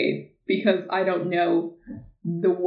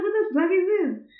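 high, disgusted disbelief; girl reacting to her first period, high and aghast; mock shock; 11 semitones higher than usual. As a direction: recoil at it in a high voice. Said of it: "I was like".

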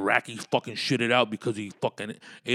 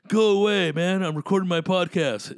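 louder and higher, shooing; mock shout; 7 semitones higher, 7 dB louder than usual. Shooing a pretend visitor away from his podcast, yelling. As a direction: yell through the door to go away.

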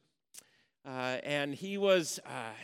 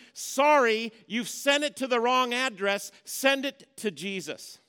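loud, high, emphatic return-to-sender; bold rebuttal; 9 semitones higher, 12 dB louder than usual. Acted. Pastor showing the church how to refuse accusations, loud and bold. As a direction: fling it back, it's not yours. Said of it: "pastor acts out rejecting accusations".

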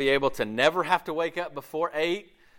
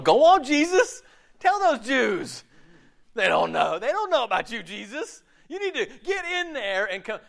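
cheering mock congregant; 10 semitones higher, 5 dB louder than usual. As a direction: urge Jesus on like a smug fan. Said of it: "We're going".

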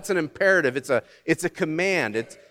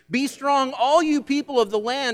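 proclaimed; 10 semitones higher than usual. Acted. Preacher proclaiming scripture.

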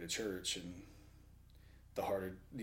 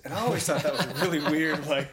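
loud, high, laughing through the words; 6 semitones higher, 11 dB louder than usual. Real, not acted. Woman telling a childhood choir story, laughing. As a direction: break into laughter while talking, raised voice. Amused at her own childhood memory.

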